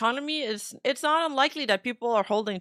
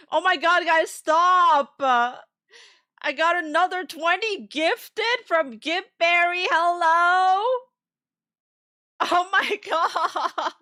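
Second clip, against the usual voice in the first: excited shouting; 5 semitones higher, 5 dB louder, slower than usual. Real, not acted. More gifted subs arrive.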